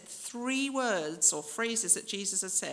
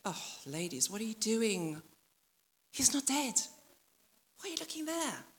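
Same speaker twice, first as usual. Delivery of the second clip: scolding tone; 3 semitones higher than usual. Retold or imitated. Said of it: "a little bit of tone like".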